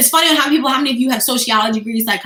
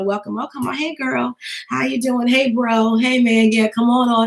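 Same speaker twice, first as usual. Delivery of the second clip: half-dead greeting. Acted. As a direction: drone out greetings like a worn-out streamer. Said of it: "you look half dead".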